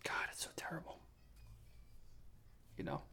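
breathy under-the-breath whisper; whispered aside. Real, not acted. Podcaster privately groaning about Ohio, whispering. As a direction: mutter it to yourself, barely voiced.

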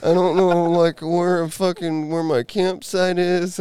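loud, high, drawn-out wail; mock crying. Drunk guy tells the officer he's lost his campsite, mock crying. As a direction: imitate a lost drunk blubbering. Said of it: "And he's crying".

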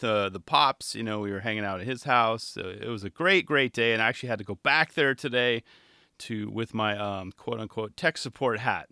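ordinary delivery

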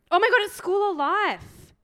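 high, loud delighted recognition; excited. Real, not acted. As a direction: gush with recognition. Recognizes the brand.

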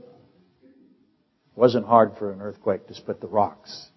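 ordinary talk

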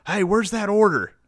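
loud, high Texan holler; Texan accent. Retold or imitated. Imitating her hollering from the kitchen, loud Texan accent. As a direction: call it out in a big Texan accent. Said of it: "Like, Texan as fuck".

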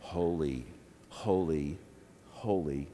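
very low and soft, spaced apart; hushed reverent refrain. Retold or imitated. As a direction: begin the worship refrain quietly. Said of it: "we started singing".

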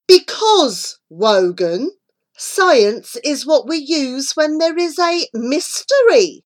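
patient explaining. A narrator's reading. Flora explaining to Wogan why science helps, patiently. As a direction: spell it out for a slow friend. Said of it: "replied Flora patiently".

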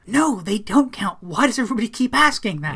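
fed up with the same question; mildly voiced irritated aunt; exasperated aunt. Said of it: "Aunt Helene goes".

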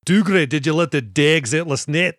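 loud hammed-up Scottish brogue; comical Scottish accent. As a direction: do a silly cartoon Scot. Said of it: "a comical Scottish accent".

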